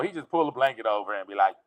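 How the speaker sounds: ordinary talk, nothing marked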